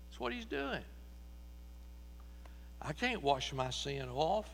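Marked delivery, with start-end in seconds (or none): none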